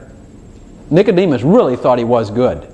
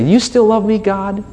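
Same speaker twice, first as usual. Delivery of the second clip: higher-pitched, hopeful begging question; timid pleading; 7 semitones higher than usual. Acted. Sinner at God's door before church, timid pleading. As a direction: ask sheepishly to be let back, pitched up.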